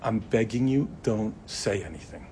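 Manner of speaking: soft, begging whisper in her ear; pleading whisper. Retold or imitated.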